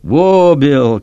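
loud high call to the horse; whoa, loud and urgent. A narrator's reading. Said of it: "Kip called out".

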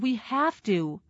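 understated desperate plea; mild wail. A narrator's reading. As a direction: insist with a light wail. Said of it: "Howard wailed".

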